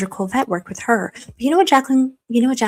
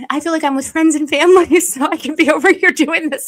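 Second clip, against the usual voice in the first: laughing at herself; 6 semitones higher, 5 dB louder than usual. Real, not acted.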